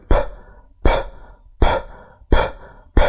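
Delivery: "ب" as a bare English p, only air; voiceless P puff. Shown as a demonstration. Teacher showing how to make the sound, "ب" as a voiceless burst of air. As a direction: lips pressed, then a sudden puff for "ب". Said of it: "تضم شفايفك وفجأة كده يطلع هوا".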